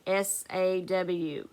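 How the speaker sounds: slow letter-by-letter spelling; slow spelled-out letters; spelling aloud. Shown as a demonstration. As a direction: spell each letter slowly for the class. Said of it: "I hope you spelled it correct".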